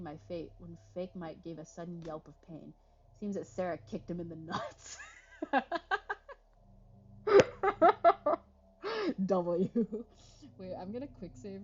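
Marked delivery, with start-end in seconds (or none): 5.0-6.3 loud laughter. Real, not acted. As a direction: burst of high cackling laughter. Laughing at kick in the nuts.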